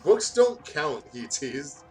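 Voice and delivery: ordinary talk, nothing marked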